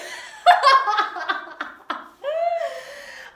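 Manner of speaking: long, high, loud laughing fit; real helpless cackle. Real, not acted. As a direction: crack up at your own silliness. Laughs at own terrible accent.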